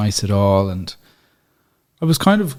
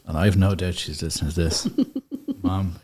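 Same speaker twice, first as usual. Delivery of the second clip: ordinary talk, nothing marked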